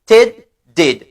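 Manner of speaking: "Ted, did" avec le D final bien articulé; ted did drill. Shown as a demonstration. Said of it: "Répétez après moi". (prononcez "Ted, did" en détachant chaque D)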